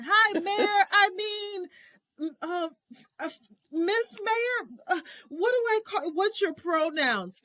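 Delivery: very high, stammering and flustered; nervous high babble. Retold or imitated. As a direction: trip over your words in awe. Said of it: "I'm like".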